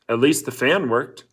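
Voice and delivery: usual speaking voice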